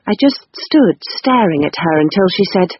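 ordinary delivery, nothing marked

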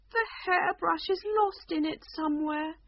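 high, forlorn queen's lament; unhappy. Acted. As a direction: sound sorry for yourself, like a lost child. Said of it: "the Queen said unhappily".

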